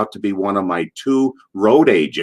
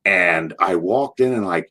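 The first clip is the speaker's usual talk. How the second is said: ordinary talk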